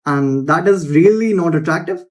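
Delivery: "attractive" lifts up at the end; uptalk, rising on "attractive"; high-note ending. Shown as a demonstration. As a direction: finish on a questioning "attractive". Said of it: "end my sentences on a high note".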